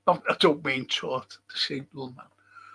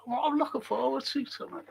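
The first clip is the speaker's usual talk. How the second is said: higher, softer quoting voice; imitating a viewer; 10 semitones higher, 7 dB quieter than usual. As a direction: lightly voice an eager well-wisher, high and soft. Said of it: "when someone says that".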